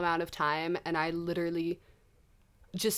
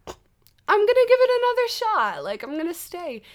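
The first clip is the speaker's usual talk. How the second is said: much higher, chirpy imitation of her old self; hopeful past self; 12 semitones higher, 5 dB louder than usual. Mocking her past decision to stay, chirpy hopeful voice. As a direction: play your naive past self brightly. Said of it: "that I was like".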